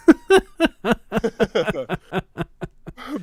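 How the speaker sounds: loud, high burst of laughter; giddy shouting laugh; elated laughing. Real, not acted. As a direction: laugh out the thrill. Elated after inner cheer.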